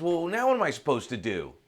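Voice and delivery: high, loud, helpless question; exasperated. Acted. Seeker asks the shirtless man what now, exasperated. Seeker at a loss after reveal.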